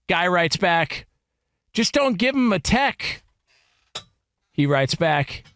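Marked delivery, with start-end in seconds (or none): 1.7-3.2 a higher mock voice for the replier; high voiced reply. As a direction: voice pitched well up for the other guy. Reading reply in another voice.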